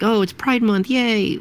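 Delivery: high mock-cheerful "yay"; sarcastic cheer. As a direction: sing it out with fake enthusiasm. Mocking the cheerful Pride Month line.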